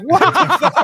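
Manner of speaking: very high incredulous yelp breaking into laughter; squeaked, laughing outburst; laughing disbelief. Retold or imitated. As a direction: retell your shock, cracking up. Retelling own baffled reaction, laughing.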